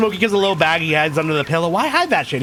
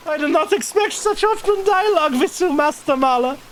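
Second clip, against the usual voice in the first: put-on higher-pitched German-accented character; 12 semitones higher than usual. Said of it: "went for the German accent with this guy".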